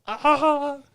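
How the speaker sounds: high-pitched, loud cooing cry; high imitated "ah". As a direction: squeal an "ah" as the little girl. Imitates adorable girl's reaction.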